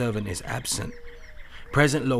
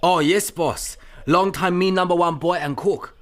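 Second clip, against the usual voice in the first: higher, louder eager pidgin; eager pretend cook; 7 semitones higher, 4 dB louder than usual. Disguised Chan eagerly claiming to be a cook, higher and louder. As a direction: sell yourself as the cook. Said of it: "a poor Chinese laborer".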